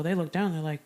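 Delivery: ordinary talk, nothing marked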